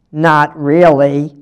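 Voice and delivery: plain reading voice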